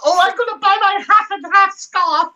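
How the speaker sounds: mock plastic fan, way up high; plastic Karen voice. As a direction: put on a shrill-free high Karen impression. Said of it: "That was a plastic Karen".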